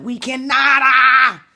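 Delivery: "we cannot" shouted high and loud, ending in a cry; passionate shout. Real, not acted. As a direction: belt it out, then let out a yell. Repeats the phrase, then shouts it.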